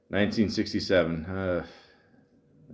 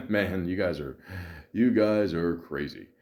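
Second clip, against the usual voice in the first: mild God voice; 4 semitones lower than usual. Retold or imitated. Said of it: "he's just like".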